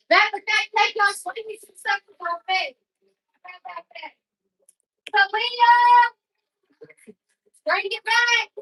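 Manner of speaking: high-pitched call across the house; calling out orders. Real, not acted. Calling out orders to children off-stream.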